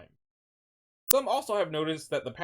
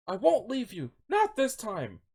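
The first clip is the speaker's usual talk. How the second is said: higher, mildly acted defiance; lightly voiced heroine refusing to go; acted defiant plea; 8 semitones higher, slower than usual. Ahsoka to Vader in their duel, mildly defiant.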